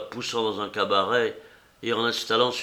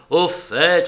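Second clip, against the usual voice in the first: loud, higher angry snap; furious shout; 8 semitones higher, 9 dB louder than usual. Acted. José furiously cutting off the rambling old woman. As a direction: cut her off in a rage. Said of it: "cria José furieux".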